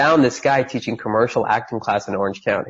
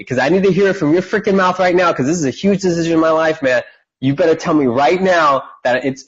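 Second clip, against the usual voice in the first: urgent demand; 6 semitones higher than usual. Retold or imitated. Demanding answer from guru.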